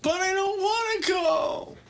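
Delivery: put-on high-pitched, loud teenage whine; mock a teenager wailing in protest; whiny teenager. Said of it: "He got the whiny fucking teenage".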